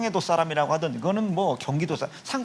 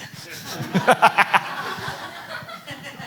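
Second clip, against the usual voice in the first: breathless laugh. Real, not acted. Laughs after the whispered joke.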